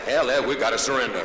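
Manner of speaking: Southern soldier drawl. Acted. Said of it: "speaking maybe too slow".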